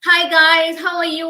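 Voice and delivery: loud, high, gushing hello; hammed-up fake enthusiasm; fake overexcited greeting. Shown as a demonstration. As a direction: overdo the cheer like a fake presenter. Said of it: "if I come over here".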